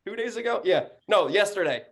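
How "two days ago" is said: ordinary delivery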